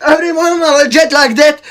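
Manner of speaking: ordinary talk, nothing marked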